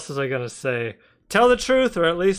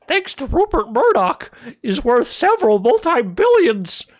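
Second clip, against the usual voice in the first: much higher, lecturing pundit impression; Jordan Peterson voice; 13 semitones higher, 3 dB louder than usual. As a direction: preach it like a high-pitched pundit. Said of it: "I should say it like a Jordan Peterson voice".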